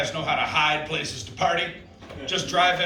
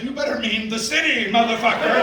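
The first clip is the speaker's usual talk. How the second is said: mock threat; 5 semitones higher, 4 dB louder than usual. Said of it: "I said".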